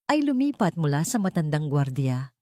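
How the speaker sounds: ordinary delivery, nothing marked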